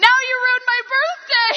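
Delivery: laugh-crying impression of a dramatic teen; mock upset teen. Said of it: "he's like".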